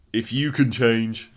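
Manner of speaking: loud, slow, hammed-up film speech; Rocky. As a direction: imitate a boxer's big rallying speech, drawn out. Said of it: "Rocky stands up and goes".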